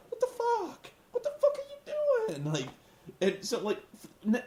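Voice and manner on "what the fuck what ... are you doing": very high, squeaky disbelief; hammed-up high-pitched exasperation; Douglas Williams. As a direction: squeal it like a flustered, offended wrestler. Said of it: "Doug is like".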